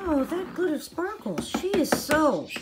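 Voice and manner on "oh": ordinary talk, nothing marked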